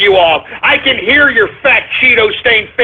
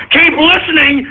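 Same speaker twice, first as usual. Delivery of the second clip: ordinary talk, nothing marked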